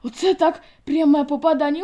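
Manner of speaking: excited cheer. Acted. Sol cheering the slap.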